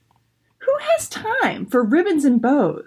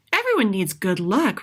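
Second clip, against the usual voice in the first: usual speaking voice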